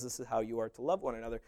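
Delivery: ordinary talk, nothing marked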